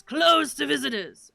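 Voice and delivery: loud, forceful gatekeeper line; stern refusal. Acted. Voicing a guard barring entry.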